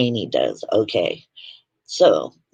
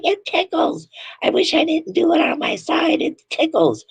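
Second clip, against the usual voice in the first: much higher, giggling imitation of the daughter; mock giggly high voice. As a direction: squeak it out like a ticklish kid laughing. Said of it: "laughed her ass off the whole time".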